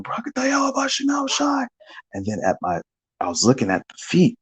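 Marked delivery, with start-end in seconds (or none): 0.0-1.7 screaming. Retold or imitated. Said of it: "So I start screaming".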